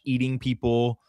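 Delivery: normal talking voice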